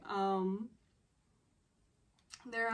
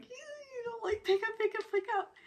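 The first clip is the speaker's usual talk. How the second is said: high, excited mock pleading; frantic urging. Sisters begging her to pick up the phone, frantic urging. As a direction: urge it repeatedly, pitched way up.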